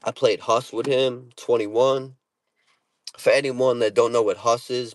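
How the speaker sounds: ordinary talk, nothing marked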